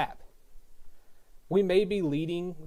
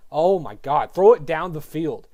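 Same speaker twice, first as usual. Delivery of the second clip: louder, exasperated shout at the coaches; frustrated yell; 8 dB louder than usual. Real, not acted. Fan ranting at offense.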